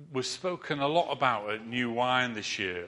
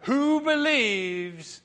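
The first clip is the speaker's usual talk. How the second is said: slow, louder ringmaster call to the crowd; showman challenge; 5 semitones higher, 7 dB louder, slower than usual. Retold or imitated. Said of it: "he says to them".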